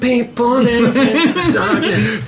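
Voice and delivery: high, very slow mock-dramatic line; hammed-up movie narrator; dramatic trailer voice. Joking about his own heroic CCTV leap, in a mock-dramatic movie voice.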